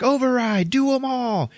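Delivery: high, frantic mock order; acted desperate command. She orders all the procedures at once, mock-frantic. Acts her overruling the machine.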